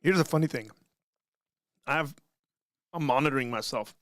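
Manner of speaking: chewing while talking; talking through a mouthful of food; mouth full. Real, not acted. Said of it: "can hear myself chewing".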